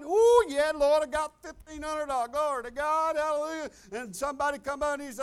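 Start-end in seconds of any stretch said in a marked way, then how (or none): 0.0-3.7 higher, louder joyful praise; gleeful hallelujah over a paycheck; excited praise. Retold or imitated. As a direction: burst out celebrating the money. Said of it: "ain't that like God".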